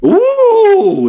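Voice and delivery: very high, loud squealed ooh; goblin ooh. Acted. Goblin ticket-taker impressed by the answer, high squeal.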